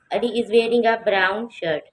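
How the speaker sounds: very slow, clearly spaced English; slow model reading. Shown as a demonstration. Teacher modelling the sentence slowly for students. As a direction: read it slowly for the class. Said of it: "teacher models sentence".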